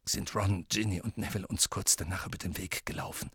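hushed, near-whispered voice; Lupin. Acted. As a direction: speak very softly, almost voiceless.

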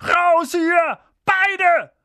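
very high, strained loud order; croaked shout. Acted. Uncle ordering both boys out, strained high shout. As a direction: shout them out in a panic. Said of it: "krächzte Onkel Vernon".

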